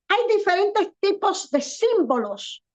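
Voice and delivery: ordinary talk, nothing marked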